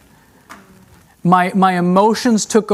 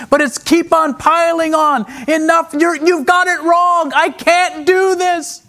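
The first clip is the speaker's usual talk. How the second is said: retold crying out to God; 7 semitones higher than usual. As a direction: relive begging God for relief, the voice rising to a near-breaking high. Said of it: "crying unto God and just saying".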